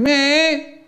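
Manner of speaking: put-on high whining child; whiny pupil begging the teacher to breathe; whiny kid. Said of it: "a whiny kid going".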